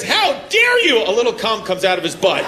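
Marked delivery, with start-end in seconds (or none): 0.0-1.0 high, slow, affected "How dare you?"; mocking a man's prissy retort. Man snapping at his wife in a prissy mock voice. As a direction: camp it up, drawn out and way up high. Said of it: "every time a man says".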